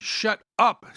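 exasperated yell above squabbling friends; screamed order. A narrator's reading. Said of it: "screamed Jim above the din".

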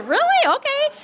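high, squeaky disbelief; exaggerated high-pitched disbelief; mock incredulity. Retold disbelief at partner's ignorance.

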